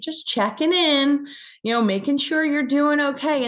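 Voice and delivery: lightly voiced tender grandma; grandma's gentle voice. Grandmother's gentle words in dream.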